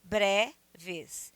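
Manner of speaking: "breves" broken as bre, pause, ves; split into syllables. Shown as a demonstration. Teacher counting syllables, "breves" pulled apart as bre-ves. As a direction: say "breves" as two separate beats. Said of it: "uma sílaba só".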